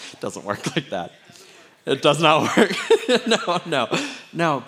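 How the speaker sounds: loud laughing denial. Real, not acted. Therapist pastor joking about himself, laughing loudly. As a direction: laugh through the words.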